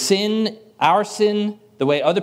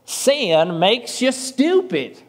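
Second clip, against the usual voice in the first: slow, drawn-out imitation of a Southern professor's accent; 6 semitones higher, much slower than usual. Quoting his Southern seminary professor, slow Southern accent. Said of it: "he had the most amazing Southern accent".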